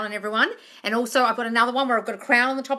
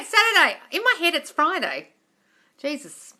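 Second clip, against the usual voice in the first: ordinary delivery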